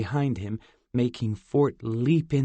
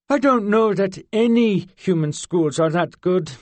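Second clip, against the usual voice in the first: higher, louder, dismissive fairy; haughty fairy girl; 8 semitones higher, 7 dB louder than usual. A narrator's reading. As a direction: brush off human schools. Zinnia dismissive.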